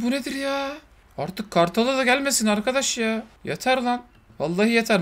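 ordinary delivery

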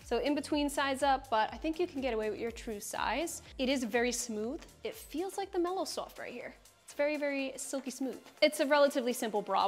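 normal talking voice

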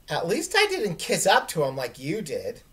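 ordinary talk, nothing marked

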